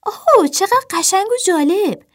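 high, loud squeal of delight; gushing, high-pitched exclamation; excited cry. A narrator's reading. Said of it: "اپل فریاد زد".

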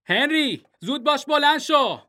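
high, loud yell up the stairs; father shouting his son awake; dad yelling. A narrator's reading. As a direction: bellow at the boy to get up. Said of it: "بابا هوار کشید".

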